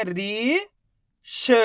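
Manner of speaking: री held long, then a separate श; drawn-out drill on री and श; sounding out syllables. Shown as a demonstration. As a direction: stretch री, pause, then draw out श. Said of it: "तो कैसे पढ़ेंगे?".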